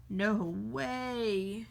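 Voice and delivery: ordinary delivery, nothing marked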